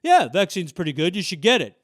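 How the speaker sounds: offhand imitated endorsement; flippant. President to supporters about the vaccine, imitated flippantly. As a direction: toss the advice off as if it hardly matters. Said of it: "when he flippantly says".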